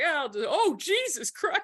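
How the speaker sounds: startled high exclamation on watching nunchucks; mock alarm, voice jumping up; shocked. Host reacting to guest's nunchuck reel, startled. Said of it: "And then I was like".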